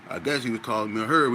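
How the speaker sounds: normal talking voice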